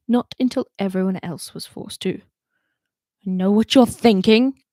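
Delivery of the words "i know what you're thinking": stern. A narrator's reading. Commander lecturing.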